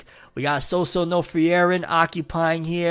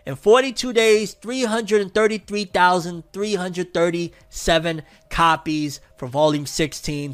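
ordinary delivery

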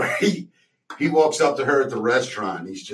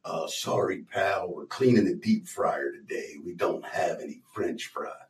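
low gruff Jack voice; 6 semitones lower, 8 dB quieter than usual. Retold or imitated. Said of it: "he goes".

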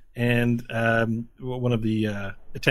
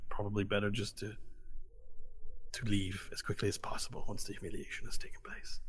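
hushed; 2 semitones lower, 10 dB quieter than usual. Acted. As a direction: advise in a quiet hush. Mentor advising quietly.

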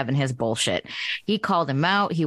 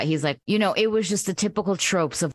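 ordinary talk

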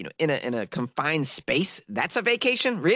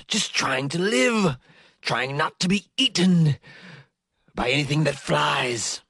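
slow, hushed nature documentary narrator; lightly voiced wildlife narrator, slow and awed; David Attenborough; slower than usual. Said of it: "The, the nature guy?".

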